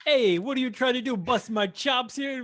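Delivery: mock New York cop complaining. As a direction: put on a comic New York accent.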